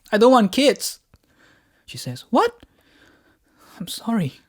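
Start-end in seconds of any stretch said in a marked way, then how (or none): 0.1-1.0 sudden loud, high blurt. Acted. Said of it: "blurted out". 2.3-2.7 very high, loud stunned rise; a disbelieving gasp of a question; shocked what. Acted. Wife stunned by confession.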